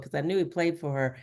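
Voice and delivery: ordinary talk, nothing marked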